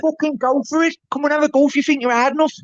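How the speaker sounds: playful Scouse taunt; mock Scouse. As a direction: goad them in a jokey Scouse accent. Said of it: "I can do a bit of scouts".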